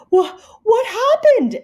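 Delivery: high, loud startled teacher. Acted. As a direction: gasp it out in shock.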